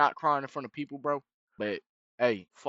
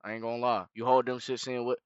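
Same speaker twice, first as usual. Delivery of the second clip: normal talking voice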